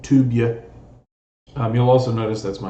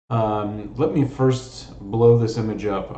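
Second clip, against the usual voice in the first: ordinary delivery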